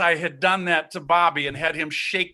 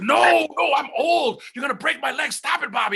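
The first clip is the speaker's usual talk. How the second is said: high, loud mock pleading; panicked protest; 8 semitones higher, 3 dB louder than usual. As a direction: act out begging off a fight. Acted protest against wrestling.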